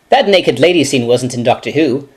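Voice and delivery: ordinary delivery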